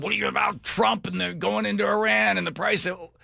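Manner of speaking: lightly voiced reporter with a mic; mock TV reporter. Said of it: "let's run around".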